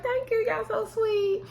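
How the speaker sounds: high, light and slow, mock sugary; squeaky-sweet retold thank-you, said slowly. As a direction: lift way up into a syrupy thank-you. Quoting Keyshawn thanking dancers.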